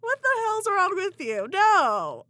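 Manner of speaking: outraged at what he did; indignant outburst. Real, not acted. Telling her friend about her ex, loud indignant no.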